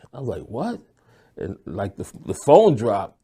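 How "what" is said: high stunned what; shocked. Retold or imitated. Hears on the phone his friend was killed, stunned high disbelief. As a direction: disbelief at the news. Hearing of the killing.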